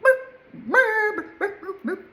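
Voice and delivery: very high squeaky imitation of a whining dog; mock whine and bark. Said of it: "It wasn't a very accurate whine and bark".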